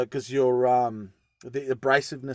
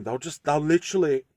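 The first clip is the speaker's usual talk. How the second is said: ordinary talk, nothing marked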